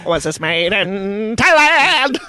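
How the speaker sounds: normal talking voice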